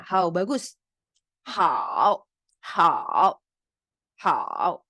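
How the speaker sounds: "Hao" said slowly and clearly; clear Mandarin hao. Shown as a demonstration. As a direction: model "Hao" for students. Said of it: "H A O".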